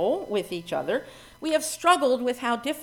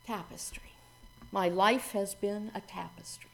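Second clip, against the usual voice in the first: whispered; 2 semitones lower, 10 dB quieter than usual. Acted. Minister to congregation, opening the poem in a whisper.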